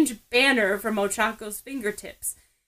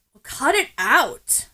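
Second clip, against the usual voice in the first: slow, louder annoyed order; 4 semitones higher, 5 dB louder than usual. A narrator's reading. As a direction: lean on it, fed up. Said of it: "Bakugo is annoyed".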